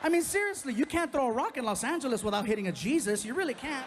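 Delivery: loud, high exasperated rant. Real, not acted. Comic telling the crowd, exasperated and loud. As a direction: throw it out to the crowd, fed up.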